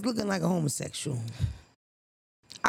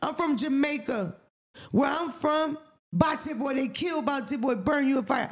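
mock Jamaican patois, higher; Jamaican accent; 5 semitones higher than usual. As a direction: imitate a Jamaican accent, hammed up. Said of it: "He said".

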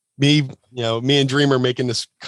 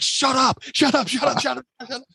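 very high hissed repeated shut up, fading to nearly silent; frantic hush; 12 semitones higher than usual. Retold or imitated. Friends silencing Sandman near the cop, frantic and high. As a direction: shush him urgently.

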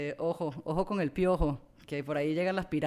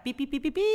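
censor bleep; 11 semitones higher, 3 dB louder, faster than usual. Retold or imitated.

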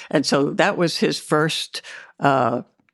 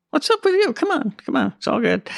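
brash jocular; 9 semitones higher than usual. Retold or imitated. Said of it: "he elbowed me and said".

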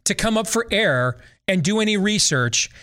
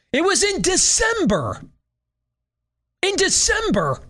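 "December" driven very high; incredulous emphasis; 13 semitones higher than usual. Real, not acted. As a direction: repeat "December" high, exasperated and insistent. Exasperated repetition of the date.

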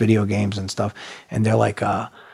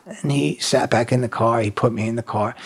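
plain reading voice

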